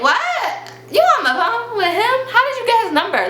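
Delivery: high, loud disbelieving questions; stunned outrage. Retold or imitated. To the best friend on the phone, high stunned questions. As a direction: demand answers, caught off guard.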